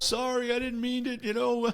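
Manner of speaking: high, pleading imitation; mock begging. Attorney on his knees to officer, mock begging. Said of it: "He got on his knees".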